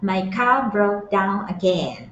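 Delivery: very slow and deliberate; slow model reading. Shown as a demonstration. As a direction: say it slowly so students can copy. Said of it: "teacher models the clip line".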